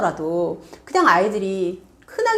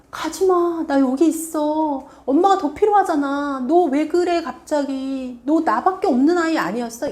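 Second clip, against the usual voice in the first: clingy pleading parent; 6 semitones higher than usual. Retold or imitated. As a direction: beg the child not to go. Parent clinging to leaving child.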